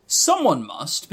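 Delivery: usual speaking voice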